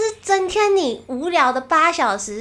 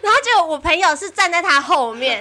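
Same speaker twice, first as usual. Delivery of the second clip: ordinary talk, nothing marked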